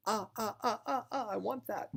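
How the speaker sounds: put-on very high, whiny little boy voice; begging child. Boy wants an action figure from mom, whining high. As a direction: whine and grab for it in a high voice.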